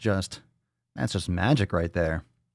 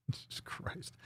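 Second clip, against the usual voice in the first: whispered aside; 21 dB quieter, slower than usual. Real, not acted. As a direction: whisper through a laugh, slow.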